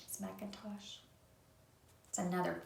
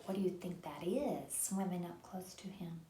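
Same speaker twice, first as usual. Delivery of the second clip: ordinary delivery, nothing marked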